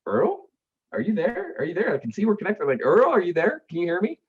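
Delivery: calling to someone who won't answer on the phone. Retold or imitated. Calling Earl on silent phone line.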